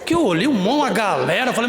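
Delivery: ordinary delivery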